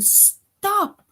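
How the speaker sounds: sharp high exclamation, Stop said in a sudden jump up; exclaimed stop. Shown as a demonstration. Teacher demonstrating an exclamation to students, sudden high Stop. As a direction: cry out Stop as a demo of feeling. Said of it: "So even if you are saying".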